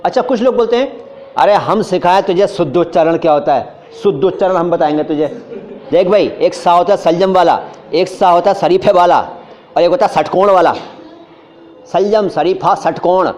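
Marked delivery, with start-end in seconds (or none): 1.4-10.7 शुद्ध, शलजम, शरीफे, षटकोण all said with a plain S; mock self-styled expert turning every श into स; botched know-it-all pronunciation. People boasting they will teach pure speech, botched S pronunciation. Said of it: "सत्यानाश कर दिया भाषा का मैंने". 11.9-13.3 सलजम, शरीफा, षटकोण all with plain S; ruined pronunciation. Shown as a demonstration. Teacher ruining the words as a warning, ruined pronunciation. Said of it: "सत्यानाश कर दिया भाषा का मैंने".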